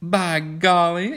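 high, drawn-out gasp of surprise; acted girlish astonishment; startled exclamation. A narrator's reading. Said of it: "exclaimed Kismine with a startled look".

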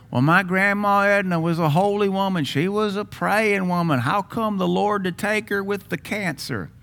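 high put-on voice of a complaining church member; mock churchy grandma-defender. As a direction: whine it like a pious relative defending Grandma.

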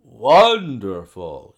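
loud, drawn-out exclamation of wonder; delighted cry. A narrator's reading. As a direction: cry out in admiration, stretching the word. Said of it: "he cried".

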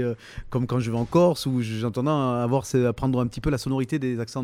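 usual speaking voice

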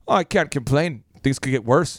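low, lightly gloomy Minnesotan; Minnesotan accent. Acted. Minnesotan asked how things are, low gloomy accent. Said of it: "In Minnesota".

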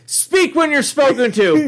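high, slow, mock stern put-down; mock stern scold. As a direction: talk down to him like a strict matron. Summer's alien captor scolding Rick.

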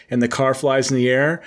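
normal talking voice

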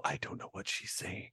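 soft, breathless whisper, half to herself; whispered aside. Retold or imitated. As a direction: whisper it as if too charmed to follow. Gushing over a Scottish lilt.

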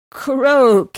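loud horse-like neigh on Croak. A narrator's reading. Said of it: "he neighed".

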